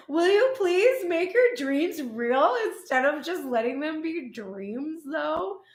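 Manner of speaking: high, loud words through laughter; laughing plea. Real, not acted. Reader begging the viewer to act on dreams, laughing. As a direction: plead while still laughing.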